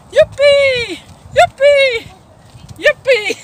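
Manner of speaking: loud, high whoops of joy; excited cheer. Real, not acted. Walker cheering Toronto friends at the virtual walk, loud whooping. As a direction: shout it like a happy rallying cry.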